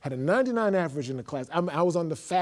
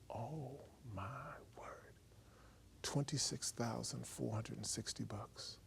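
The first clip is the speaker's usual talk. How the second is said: whisper; 3 semitones lower, 17 dB quieter, slower than usual. Real, not acted. Stunned realization.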